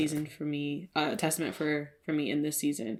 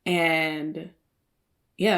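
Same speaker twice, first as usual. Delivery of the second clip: normal talking voice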